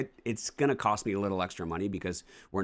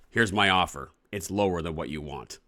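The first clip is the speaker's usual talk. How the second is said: blunt offer; 5 dB louder than usual. Retold or imitated. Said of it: "That's what it feels like".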